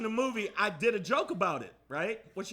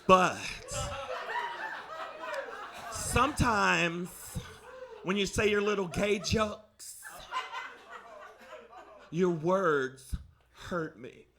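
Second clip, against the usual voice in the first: mock hurt; 3 dB quieter, slower than usual. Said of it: "he got real sensitive".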